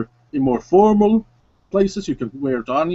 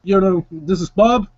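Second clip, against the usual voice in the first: [name]'s voice; 4 semitones higher than usual. Acted. [name] explains his friend to the stranger, easygoing and higher. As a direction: talk your jumpy friend down, casual. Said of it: "taps his friend and says".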